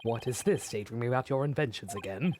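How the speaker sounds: a hint of a deep man; lightly voiced stern colleague; low male voice. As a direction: drop low for him. Voicing Hubert, a male character.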